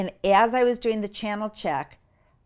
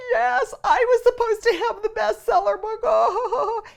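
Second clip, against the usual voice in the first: high wailing lament; mock wailing; 6 dB louder than usual. Mother mock wails about losing the book, high. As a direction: wail over the missed bestseller. Cry more over lost book.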